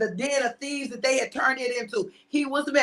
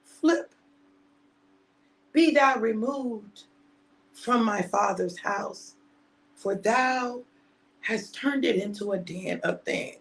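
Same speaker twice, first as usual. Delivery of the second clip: solemn King James Jesus; 3 semitones lower, slower than usual. Acted. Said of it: "Jesus said".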